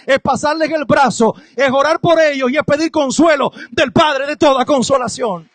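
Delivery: preaching, building to a loud shout; predicando con fuerza. Acted. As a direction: rise up and proclaim it to the church. (predicación cada vez más fuerte)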